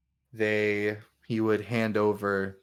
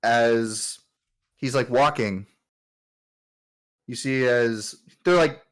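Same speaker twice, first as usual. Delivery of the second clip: usual speaking voice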